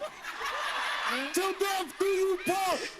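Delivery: high, drawn-out, laughing shout; hammed-up wedding-vow cry over the other speaker; theatrical outcry. Real, not acted. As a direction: holler it high, stretching each word. Repeated theatrical outcry, laughter around.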